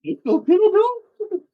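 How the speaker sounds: high, loud mock bro voice. Playing the Americans learning the truth, in a high, loud mock bro voice. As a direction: blurt it like a clueless tourist.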